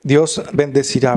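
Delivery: ordinary talk, nothing marked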